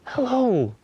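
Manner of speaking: mock surprise. Santa greets a small child.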